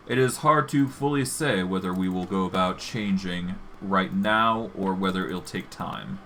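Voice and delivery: usual speaking voice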